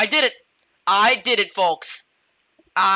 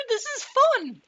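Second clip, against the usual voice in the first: high squeaky voice; 14 semitones higher than usual. Pretend. High put-on voice in gameplay.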